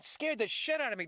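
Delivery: ordinary talk, nothing marked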